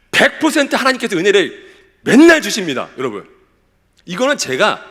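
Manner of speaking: loud, impassioned sermon delivery; preacher's ringing assurance to the congregation; emphatic preaching. Real, not acted. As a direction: declare it with total conviction, punching key words. Preaches assurance with full conviction.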